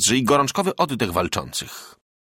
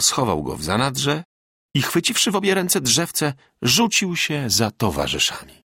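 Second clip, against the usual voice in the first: plain reading voice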